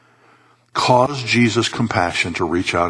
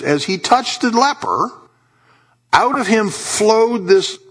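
high, emphatic preacher delivery; impassioned preaching; 10 semitones higher than usual. Real, not acted. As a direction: preach it with pitch raised, landing each phrase. Preaches at full force.

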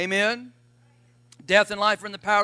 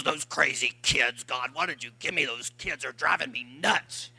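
put-on low, slow grumble about the kids; mock a worn-out parent muttering; much lower muttered complaint; 8 semitones lower, 5 dB quieter, slower than usual. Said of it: "You walk away from the kids".

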